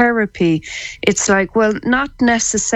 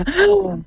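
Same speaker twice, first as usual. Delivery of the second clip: sharp gasp in; 12 semitones higher than usual. Shown as a demonstration. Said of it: "Take a deep breath in".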